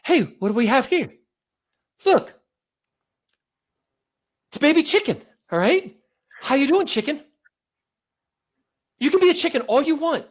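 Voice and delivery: high, out-of-breath chummy greeting; Mark Wahlberg. Acted. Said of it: "He always sounds like he's out of breath".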